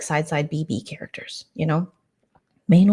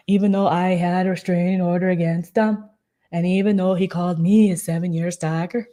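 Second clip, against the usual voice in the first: louder mock plea in a muddled put-on accent; Anne Graham; 3 dB louder than usual. Pleading grace for a former enemy, in a mock accent. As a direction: preach for grace in a hammed-up mystery accent. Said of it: "you know, just like".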